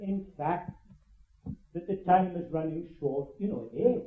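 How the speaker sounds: normal talking voice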